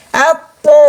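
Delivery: "Apo" as heavy t, back of tongue against the palate, o-coloured; thick, tongue-pressed THO. Shown as a demonstration. Teacher demonstrating idbaq, very thick "Apo". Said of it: "ada suara dari pangkal lidah yang menempel".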